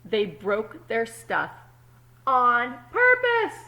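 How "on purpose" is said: on purpose said loud and high, stretched; emphatic. Acted. Teacher stressing the eggs' naughtiness, loud and slow. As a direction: punch on purpose, slowly.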